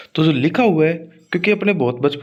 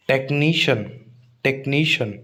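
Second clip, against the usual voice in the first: Technician as tek-NEE-shun, नी held; stress before shun. Shown as a demonstration. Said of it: "शन से तुरंत पहले वाला". (टेक्नीशियन के नी पर ज़ोर)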